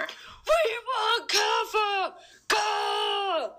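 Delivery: high, laughing slow war shout; battle cry. Acted. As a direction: roar it as the army charges. Mongol army charging Kaffa.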